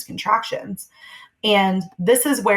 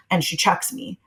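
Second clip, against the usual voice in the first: ordinary delivery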